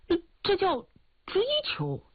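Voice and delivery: 音调猛然拔高，磕磕绊绊地辩解; 急着表白，高声卡壳; 结巴辩解. Acted. Flustered suitor explains himself. (追求者拔高嗓门、吞吞吐吐地辩解)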